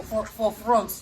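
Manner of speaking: usual speaking voice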